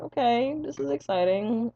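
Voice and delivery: high, slow mock-enthused okay; sarcastic. To the nurse asking endless questions, slow sarcastic enthusiasm. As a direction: fake cheer that drips with sarcasm.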